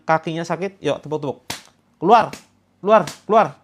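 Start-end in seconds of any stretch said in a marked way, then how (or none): 2.0-3.6 loud fierce shout of keluar; loud commanding keluar. Shown as a demonstration. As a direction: snap it out like expelling something. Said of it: "Yang kenceng, yang galak".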